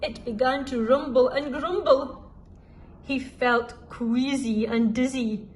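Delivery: ordinary delivery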